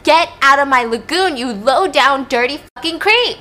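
loud, high acted tirade; furious yell. Kaya throwing rocks at Tate, acted fury. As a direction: drive him away with an angry yell.

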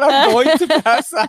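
squealed mock panic. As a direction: ham up being about to faint. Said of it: "he said".

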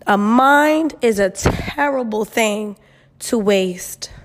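slow, weighty proverb delivery; slow saying. Shown as a demonstration. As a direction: spell the old saying out slowly. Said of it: "I used to always hear that".

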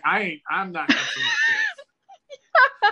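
high, laughing, shouted cop voice; laughing mock cop. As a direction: play the spooked cop while cracking up. Mocking the spooked cops.

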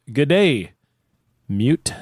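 exaggerated Kiwi vowels, "mate" said as "mute"; hammed-up New Zealand accent, vowels shifted. Shown as a demonstration. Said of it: "So here we go".

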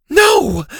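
high, loud alarmed yell. A narrator's reading. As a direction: shout to stop him. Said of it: "Zeta yelled".

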